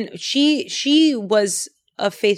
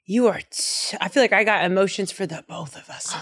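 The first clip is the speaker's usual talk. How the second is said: ordinary talk, nothing marked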